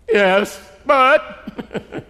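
concede, then push back on "but"; reluctant admission. Acted. Cornered worker still objecting, loudly reluctant. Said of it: "the toughest question to answer".

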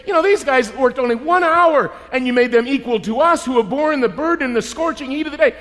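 loud, high-pitched indignant protest; grumbling complaint. Acted. Workers protesting equal pay to landowner, aggrieved. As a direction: complain loudly about unequal treatment. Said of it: "they start grumbling, saying".

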